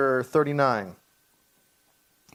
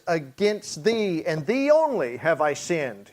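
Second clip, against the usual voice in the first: second "thee" raised and drawn out; stress on THEE. Acted. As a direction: slowly lean on "thee only". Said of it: "putting the emphasis on, on sinning against God".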